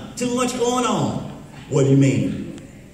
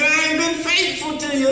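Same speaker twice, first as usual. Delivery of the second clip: mock dying wife; 8 semitones higher than usual. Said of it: "She said".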